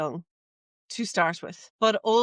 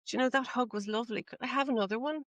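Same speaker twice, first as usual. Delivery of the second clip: sheepish; 4 semitones higher, 6 dB quieter, faster than usual. Retold or imitated. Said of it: "almost sheepishly".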